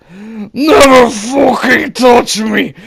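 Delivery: furious high screaming; raging yell, slow and cracking. Retold or imitated. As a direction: scream it, losing control. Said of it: "Me still screaming and stumbling over my words".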